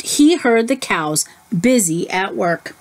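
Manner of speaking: ordinary delivery, nothing marked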